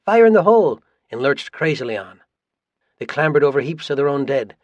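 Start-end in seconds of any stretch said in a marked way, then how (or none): 0.0-0.8 high, loud mock-gleeful shout; much higher, louder battlefield cry; giggling cry. A narrator's reading. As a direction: shout it high as a crazed warning. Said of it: "with the giggling cry".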